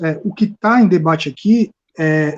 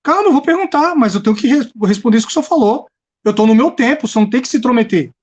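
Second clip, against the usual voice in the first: higher, fast, heated pushback; irritated protest; 5 semitones higher, faster than usual. Real, not acted. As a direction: defend your turn, heated and quick. Debatedor interrompido protesta. (protesto irritado e acelerado)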